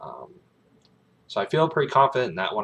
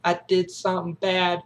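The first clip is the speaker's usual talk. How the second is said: put-on little-boy confession; 10 semitones higher than usual. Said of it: "He's like".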